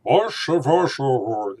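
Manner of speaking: mock the mumbled "Bosh, bosh, bosh" sound; mushy Discovery Klingon. Shown as a demonstration. Mocking the new Klingon sound, slurred and mushy. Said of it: "Not like".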